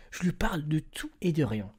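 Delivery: soft unvoiced whisper. Real, not acted. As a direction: breathe the words under your voice. Murmured aside about long chat.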